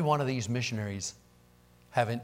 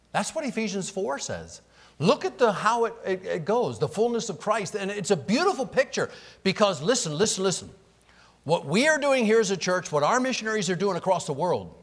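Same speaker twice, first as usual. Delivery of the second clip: raised, louder preaching voice; 7 semitones higher, 4 dB louder than usual. Acted. Pastor urges the church on about missionaries, preaching fervently. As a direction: preach it out with rising fervour.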